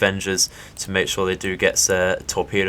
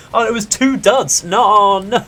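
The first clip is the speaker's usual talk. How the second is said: high, louder real dismay; dismayed groan; 10 semitones higher, 5 dB louder than usual. Real, not acted. Gamer seeing two duds hit, wailing no. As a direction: wail at the duds.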